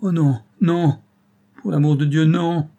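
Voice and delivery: put-on old man crouched at Robert's feet, hoarse cry. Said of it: "cria-t-il d'une voix rauque".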